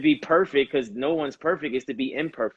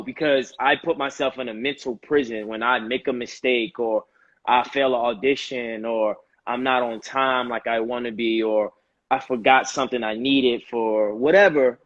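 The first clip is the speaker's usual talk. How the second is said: usual speaking voice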